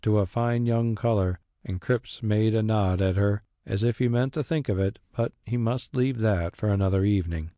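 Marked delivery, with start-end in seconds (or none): none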